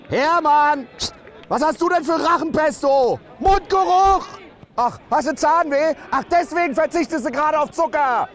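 shrill fan teasing player Andi; shrill heckler. Acted. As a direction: needle him from the stands. Heckle about bad breath.

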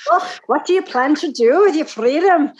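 trying a Highland Scottish lilt; imitating Highland accent. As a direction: attempt a Highlander, half-hearted. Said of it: "I could almost get there if I practice".

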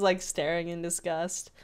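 ordinary talk

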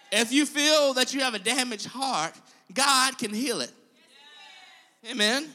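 loud preaching build. Acted. Preacher urging the congregation, loud and full.

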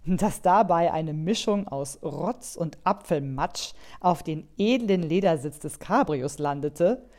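usual speaking voice

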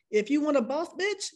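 ordinary delivery

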